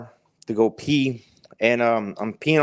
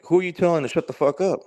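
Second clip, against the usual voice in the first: higher, quick mock challenge; mildly angry dog; 6 semitones higher, faster than usual. Dog answers man's silent insult, mildly angry retort. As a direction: lightly voice an indignant dog talking back. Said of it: "he's really angry".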